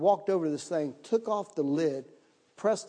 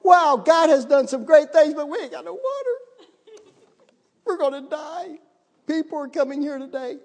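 much higher, frantic whine of panic; mock panic; 9 semitones higher, 4 dB louder than usual. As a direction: squeal it like someone about to die. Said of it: "I'm sitting over there going".

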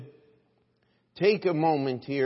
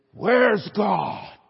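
loud, higher-pitched preacher's challenge; stern demand; 5 semitones higher, 6 dB louder than usual. Acted. Old preacher making a point to a little brat, stern loud demand. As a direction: boom the question at the child.

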